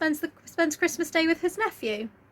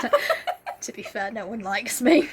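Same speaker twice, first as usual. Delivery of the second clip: laughing mock admission; 4 semitones lower than usual. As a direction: chuckle it out like Scrooge admitting it. Scrooge laughing at himself.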